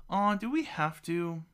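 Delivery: put-on high-pitched childish whine; complaining. A narrator's reading. Twin girls told to go to bed, complaining. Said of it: "they both complain".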